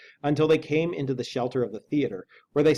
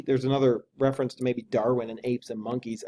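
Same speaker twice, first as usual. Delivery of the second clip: usual speaking voice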